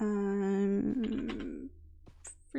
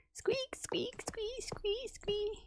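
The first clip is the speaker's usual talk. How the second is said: high, whispered squeak squeak noises; mock pig squeaks; 13 semitones higher, 8 dB quieter, much slower than usual. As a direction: squeak it like a little pig, teasing. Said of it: "It sounds like a pig".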